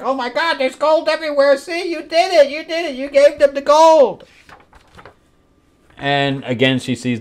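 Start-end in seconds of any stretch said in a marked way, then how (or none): 0.0-4.2 very high, squealing excitement; hammed-up falsetto amazement; mock overexcited man. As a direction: imitate him freaking out with delight. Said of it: "and he's like".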